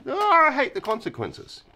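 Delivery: high, whining "Oh, I hate the consequences"; hammed-up whine about the consequences; whiny mock complaint.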